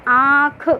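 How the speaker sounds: आँख said slowly, its nasal vowel drawn out. Shown as a demonstration. Teacher showing students a nasalised vowel. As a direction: pronounce आँख slowly and carefully. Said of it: "आँख से भी उच्चारण हुआ".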